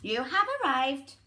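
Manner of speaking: flat robotic sat nav; mock unhelpful device voice.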